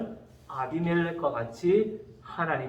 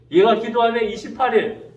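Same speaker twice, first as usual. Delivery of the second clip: louder acted noticing; raised, insistent voice imitating God counting; emphatic countdown; 3 semitones higher, 4 dB louder than usual. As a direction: announce the next day with more force. God counts down judgment, second take.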